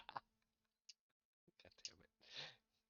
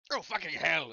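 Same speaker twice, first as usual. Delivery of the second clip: alarmed outburst; 5 semitones higher, 12 dB louder than usual. Acted. Character sees monster and flees.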